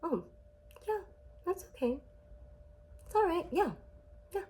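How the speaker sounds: small, high, halting noncommittal replies; grudging mock approval, doled out in little bits; unimpressed parent response. Narcissistic parent responding to child's good news, unimpressed. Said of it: "your narcissistic parent responds like this".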